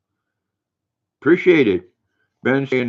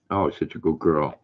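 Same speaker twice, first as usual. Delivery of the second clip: soft, lower praise for the dog; cooing to dog; 6 semitones lower, 5 dB quieter than usual. Real, not acted. Owner praising her dog, softly cooing.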